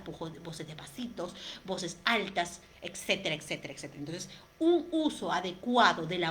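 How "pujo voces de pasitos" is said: voz susurrada, sin sonido; susúrralo como ejemplo de voz; susurro. Shown as a demonstration. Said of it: "yo sé que puedo hacer voces graves". (demostración de voz susurrada)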